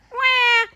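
high, loud held "wah"; mock baby cry on one high note; imitated baby wail. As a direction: mimic a little one crying for what he wants. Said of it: "He just says".

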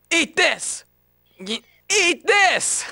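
high-pitched attack call; high, drawn-out battle cry. Acted. Acting an attack line in takes.

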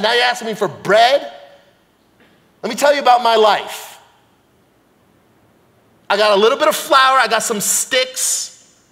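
emphatic Jersey widow venting; Jersey accent. Acted. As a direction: tell your hard luck in a Jersey accent. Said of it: "she was from Jersey".

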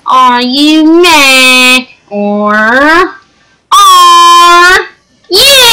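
mock teasing, squeaky ending; sing-song taunt rising to a squeal. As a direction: stretch it out, end in a high squeal.

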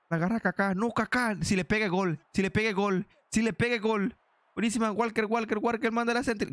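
high, urgent repeated calls; excited play-by-play. Real, not acted. Gamer calling Kaká's chance at goal, excited urgent play-by-play. Live attack near the goal.

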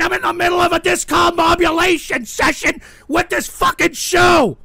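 high rant building to a loud yell; exasperated shouting. Real, not acted. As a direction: blow up, hitting the last word hardest. Host erupts at questioner.

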